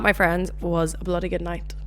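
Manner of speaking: ordinary talk, nothing marked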